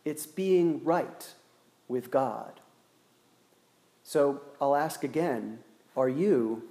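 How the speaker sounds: ordinary delivery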